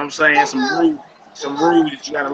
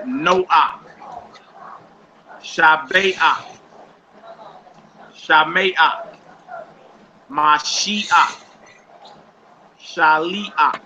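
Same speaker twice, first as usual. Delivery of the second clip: slow, syllable-by-syllable model reading; reading aloud; slower than usual. Shown as a demonstration. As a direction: read each word slowly, with pauses between. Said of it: "go ahead and read it".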